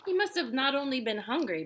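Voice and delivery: plain reading voice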